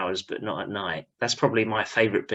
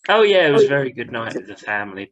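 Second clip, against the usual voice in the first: ordinary talk, nothing marked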